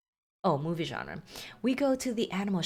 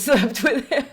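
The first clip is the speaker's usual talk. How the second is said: louder and laughing through the words, then a high laugh; 8 semitones higher, 8 dB louder than usual. Real, not acted. Player laughing at her in-game fling, then a high laugh. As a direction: crack up as you confess.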